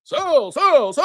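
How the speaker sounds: mock panic. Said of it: "I'm panicking right now".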